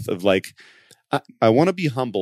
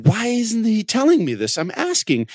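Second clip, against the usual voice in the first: frustrated; 10 semitones higher than usual. Retold or imitated. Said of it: "super frustrated about this and going".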